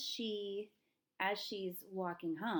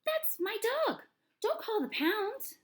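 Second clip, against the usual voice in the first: high, loud, urgent holler; 14 semitones higher, 7 dB louder than usual. A narrator's reading. Girl claims the dog from the manager, hollering. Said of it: "I hollered".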